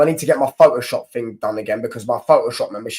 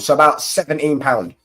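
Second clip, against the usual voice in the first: ordinary talk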